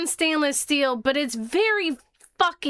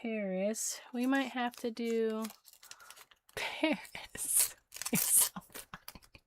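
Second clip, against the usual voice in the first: hushed whispered aside; whispering; 14 dB quieter than usual. Real, not acted. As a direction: drop to a whisper.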